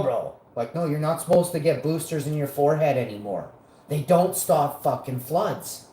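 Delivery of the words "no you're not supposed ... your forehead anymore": normal talking voice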